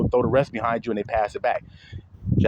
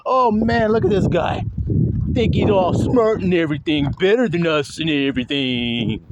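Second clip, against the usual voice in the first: mocking classmate; 2 semitones higher than usual. Classmate Nikos mocking him for reading well, imitated jeering. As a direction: mimic a scornful classmate. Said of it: "It was one guy in particular".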